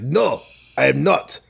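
emphatic put-on Russian accent; Boris Russian accent. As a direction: refuse like a cartoon Russian heavy, in accent. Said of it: "Boris is like".

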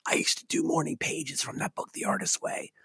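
soft breathless whisper, no voice. Retold or imitated. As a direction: hush it out like a shy fan confessing a secret. Fan rushes up, blurts confession.

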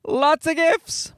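high, slow mock-weary grandparent voice; imitating grandparents bracing for gifts. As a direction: sigh it out like tired grandparents buried in presents. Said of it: "They're just like".

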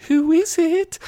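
squeaky-high imitation of a nervous question; really high-pitched voice. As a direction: act it out in a tiny falsetto. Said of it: "in this really high-pitched voice".

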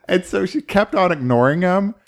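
normal talking voice

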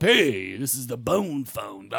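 slow low mock voicemail greeting; "the bone phone" drawn out low; imitating old message. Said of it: "my dad calling up".

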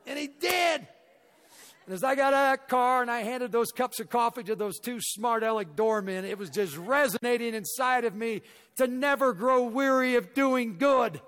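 high, loud preacher's build to the moral; preaching. Acted. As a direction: drive the lesson home like a revival preacher. Preaching the moral at full force.

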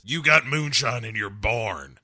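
lightly hammed-up Southern lawman; a hint of country sheriff accusation; mock Southern sheriff impression; Roscoe P. Coltrane. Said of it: "Roscoe P. Coltrane shows up and says".

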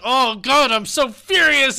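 high, loud, drawn-out divine outburst; mock-furious God. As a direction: ham up a furious God, half amused. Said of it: "God's just like".

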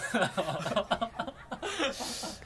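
high burst of laughter; real laugh. Real, not acted. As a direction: laugh at your own dodge. Laughs at own answer.

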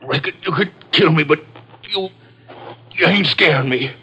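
high, halting, struggling voice; broken by pauses, pitched high under duress; strained defiance. Acted. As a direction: defy the gunman with a strained voice. Constable defies gunman, halting.

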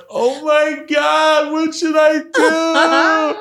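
very high, drawn-out helpless cry; mock panicked wail. Date thinks he's dying, hammed-up hysterical wail. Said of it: "she starts to panic and she starts to cry".

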